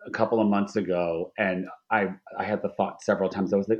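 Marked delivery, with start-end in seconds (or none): none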